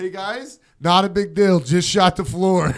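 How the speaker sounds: high, loud sing-song reassurance; mock-casual announcement. Shooter popping his head out to the bar, mock-casual call. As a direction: call it out breezily to the whole room.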